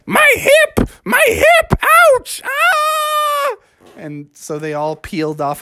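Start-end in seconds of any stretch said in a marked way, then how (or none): 0.1-3.6 very high, wailing yelps; acted falsetto howl of pain; granny in pain. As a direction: cry out like a hurt cartoon grandma. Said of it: "Granny was like".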